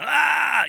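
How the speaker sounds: high, loud mock yell of an angry dad. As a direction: bark it out like a yelling man, hammed-up. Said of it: "Real men are, you know".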